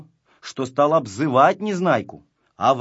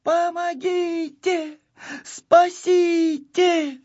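very high, drawn-out cry for help; hammed-up high scream for rescue; 4 dB louder, much slower than usual. A narrator's reading. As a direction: stretch each word into a panicked wail. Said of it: "Гунька вдруг как закричит".

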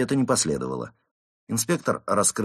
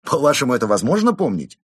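loud, raised rhetorical question; indignant; 6 semitones higher, 8 dB louder than usual. A narrator's reading. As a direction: protest at an absurd demand. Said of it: "возмутился он". (громкое возмущение)

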